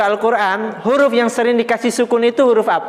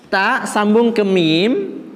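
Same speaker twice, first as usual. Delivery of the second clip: slow dictation; much slower than usual. Shown as a demonstration. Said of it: "teacher repeats step slowly".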